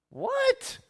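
high, startled what; mock disbelief at the story. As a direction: squeak out a shocked what. Reacts in disbelief to scripture.

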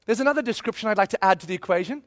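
ordinary delivery

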